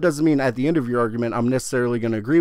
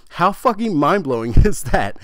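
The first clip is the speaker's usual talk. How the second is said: excited laugh; 4 semitones higher, 5 dB louder than usual. Real, not acted. Amazed outburst about the theory.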